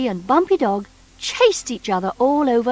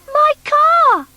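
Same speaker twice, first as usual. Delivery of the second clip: very high, loud cry of delight; joyful cry; 8 dB louder than usual. A narrator's reading. Noddy sees his lost car return, crying out with delight. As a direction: burst out in joy. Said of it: "cried Noddy".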